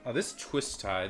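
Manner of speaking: usual speaking voice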